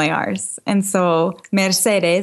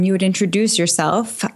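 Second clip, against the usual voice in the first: normal talking voice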